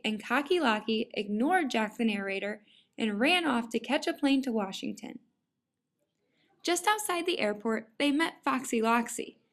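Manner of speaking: plain reading voice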